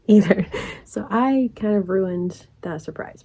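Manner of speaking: ordinary talk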